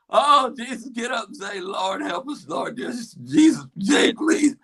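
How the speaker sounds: high, hammed-up frantic prayer; desperate pleading. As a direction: beg for the hurt player to get up. Acting out crowd praying for player.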